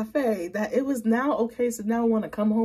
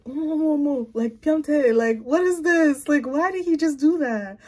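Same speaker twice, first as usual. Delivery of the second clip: higher, a touch louder, slowed mock shock; cafe lady's shock; 6 semitones higher, 4 dB louder than usual. Voicing the cafe lady who saw the date's move, mock shocked. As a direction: play the stunned onlooker. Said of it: "She's giving, like".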